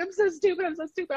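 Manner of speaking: high-pitched mock crying voice; retold crying. Wife imitating her injured husband, retold crying. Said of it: "he's just crying and crying".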